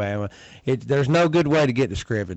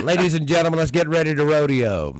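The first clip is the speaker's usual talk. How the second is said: rodeo announcer voice; 4 semitones higher, 4 dB louder than usual. Shown as a demonstration. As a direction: hype up the crowd like a fighting game announcer. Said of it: "your announcer voice there at the rodeo".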